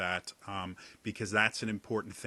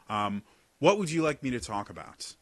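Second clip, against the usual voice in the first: ordinary talk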